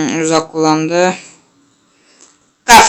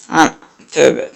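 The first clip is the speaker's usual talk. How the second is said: usual speaking voice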